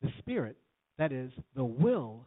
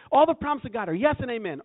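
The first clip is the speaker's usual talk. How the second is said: loud, high, exultant declaration; excited affirmation; 9 semitones higher, 10 dB louder than usual. Acted. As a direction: shout it out like a fired-up believer. Acts out own excited affirmations.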